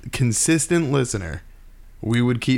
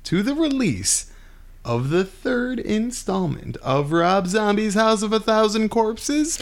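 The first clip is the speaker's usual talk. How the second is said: excited; 9 semitones higher than usual. Real, not acted. Building hype announcement.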